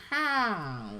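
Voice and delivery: high-pitched cartoon scream; Lenny. Imitating a Simpsons scream for the critic, high mock scream. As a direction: squeal it high without much volume. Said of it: "does a Lenny scream from The Simpsons".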